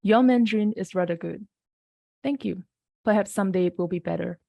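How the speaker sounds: ordinary delivery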